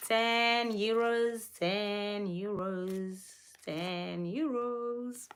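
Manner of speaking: drawn-out, stretched words, softening; slow repetition. Real, not acted. Showing viewers the ten euro note, slowly repeating it.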